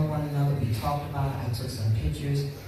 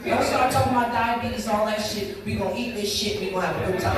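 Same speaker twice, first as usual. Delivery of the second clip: imitating Carol brushing off the lecture, higher and quick; dismissive retort; 7 semitones higher than usual. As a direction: wave off the diabetes talk, pitched up and fast. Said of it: "She said".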